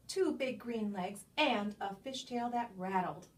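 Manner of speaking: lively picture-book read-aloud with playful swoops; reading to children. Acted. Reading a favorite picture book aloud to young listeners, animated storytime voice. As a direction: read it like storytime for a room of kids.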